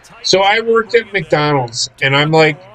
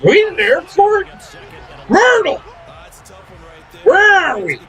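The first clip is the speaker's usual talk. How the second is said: put-on much higher, bewildered old-lady voice calling out; confused old customer; 12 semitones higher than usual. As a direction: play a lost, baffled old woman.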